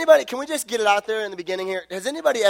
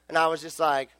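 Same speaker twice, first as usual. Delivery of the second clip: ordinary talk, nothing marked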